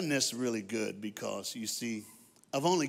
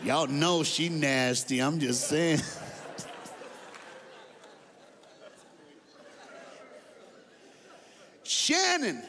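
preaching at full force. Acted. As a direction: preach it hard, driving the room.

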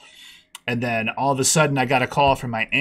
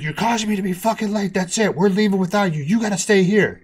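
higher, louder furious dad; angry dad; 8 semitones higher, 4 dB louder than usual. Retold or imitated. Dad scolding his late son, angry. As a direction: bark the kid off, leaving without him.

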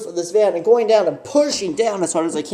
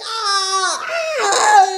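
laughing scream; 13 semitones higher than usual. Retold or imitated. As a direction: shriek while laughing. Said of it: "I'm like".

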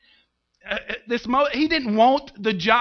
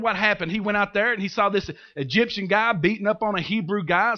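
ordinary delivery

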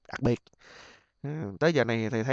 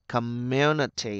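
slow community, long MU; stress on second syllable; slower than usual. Shown as a demonstration. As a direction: stretch co-MUUU-ni-ty. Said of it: "âm hai". (nhấn, kéo dài âm hai community)

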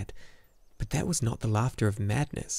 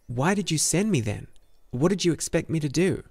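higher-pitched, indignant questioning; upset questioning; 6 semitones higher, 3 dB louder than usual. A narrator's reading. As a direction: demand an explanation, pitch rising high. Atreyu challenges the empress.

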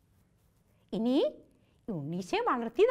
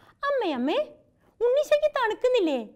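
put-on high, eager child asking mother; little girl's question; 9 semitones higher, 4 dB louder than usual. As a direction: voice the small girl, pitched up and fast. Little girl asks her mother.

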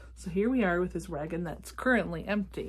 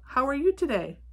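louder, higher friendly question; calling out; 7 semitones higher, 5 dB louder than usual. A narrator's reading. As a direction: call it over to a passing friend. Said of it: "Badger called out".

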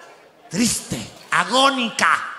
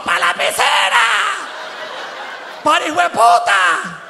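mock angry shout; 7 semitones higher than usual. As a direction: hurl the insult at the car. Brazilians throwing a Twingo.

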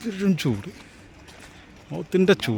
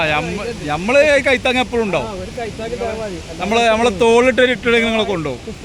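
excited; 6 semitones higher than usual. Real, not acted. Excited vlogger hyping the group.

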